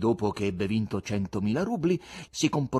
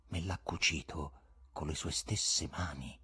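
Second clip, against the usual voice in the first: low, soft, secretive; mysterious; 5 semitones lower, 6 dB quieter than usual. A narrator's reading. Guest showing Ivan his cap, mysterious and hushed. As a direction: confide it softly, pitched down, with mystery. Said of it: "aggiunse con fare misterioso".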